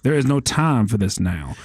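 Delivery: slow Southern drawl; Southern accent. Shown as a demonstration. Game master playing the lord of Noel, slow Southern accent. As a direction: draw the vowels out, Southern style. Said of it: "that kind of Southern accent".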